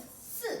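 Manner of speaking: short "Si" dropping sharply in pitch; falling tone on Si. Shown as a demonstration. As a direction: drop "Si" firmly from high to low. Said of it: "if it is come down".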